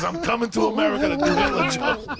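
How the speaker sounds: high, loud mock yell; hammed-up shouted declaration. Young comic announcing his move to America, in a mock shout. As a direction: proclaim it at the top of your voice, imitating him.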